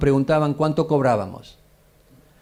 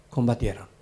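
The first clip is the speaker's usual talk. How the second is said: normal talking voice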